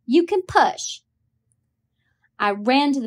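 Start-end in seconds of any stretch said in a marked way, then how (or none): 0.0-1.0 put-on child's voice. Scout answering Jem as a child.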